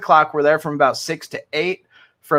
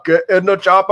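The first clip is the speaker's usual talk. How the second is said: put-on accent, a little raised; mock action-movie yell; 2 semitones higher, 4 dB louder than usual. Host echoing friend's yell for Jeanette, mock action-hero call. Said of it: "That's what we're gonna yell to Jeanette".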